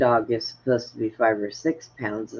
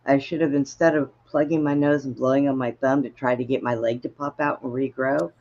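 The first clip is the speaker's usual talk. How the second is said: ordinary talk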